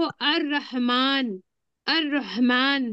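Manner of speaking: slow "अर्रहमान" with doubled R, no L; lam silent. Shown as a demonstration. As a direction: draw out "अर्रहमान", roll the double R. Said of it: "देखो यहाँ पे देखो".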